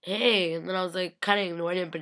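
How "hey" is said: loud "Hey!" back; called greeting. Retold or imitated. Fan calling back to Michael, a loud cheerful hey. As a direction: answer the call cheerfully.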